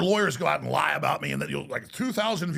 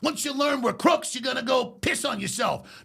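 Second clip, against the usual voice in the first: threatening mobster; 7 semitones higher, slower than usual. Retold or imitated. Mobster threatening the kid, hammed-up shouting thug. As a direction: snarl it like a mafia heavy. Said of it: "he's like".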